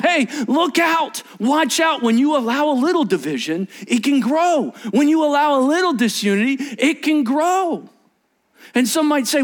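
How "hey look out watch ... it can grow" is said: urgent warning. Acted. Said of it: "And Scripture is going".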